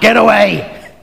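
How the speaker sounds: loud, high shout to scare it off; shouted warning. Acted. Good shepherd scaring off a bear, shouting. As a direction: yell it like chasing off a beast. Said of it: "make a loud noise and shout".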